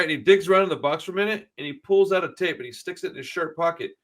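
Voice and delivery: usual speaking voice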